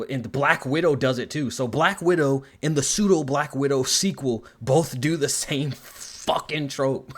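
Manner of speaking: ordinary talk, nothing marked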